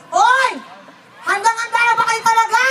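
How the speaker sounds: high, projected "are you ready" holler; high-pitched host's rallying call; rallying the crowd. Real, not acted. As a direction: fire up the audience. Rallying the audience for the show.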